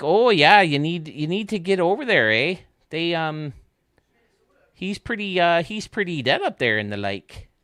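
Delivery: put-on high, loud Minnesota accent from Fargo. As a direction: lay on a chirpy upper-Midwest accent. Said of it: "speak like the Fargo".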